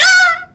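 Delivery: very high, loud cartoon scream; falling scream. Little grot flying off the plane, falling scream. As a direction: scream like a little creature flung away.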